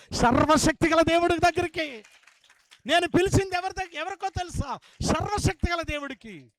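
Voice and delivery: loud pulpit exclamation; soaring sermon proclamation; preaching at full force. Acted. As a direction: lift the hall with each phrase. Preacher exalting God.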